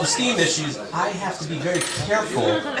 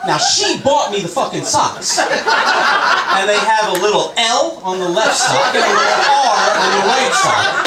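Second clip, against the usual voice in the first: usual speaking voice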